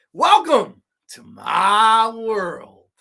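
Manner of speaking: slow menacing villain. Retold or imitated. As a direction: stretch every word like a hammy psycho. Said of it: "he is absolutely chewing up the scenery".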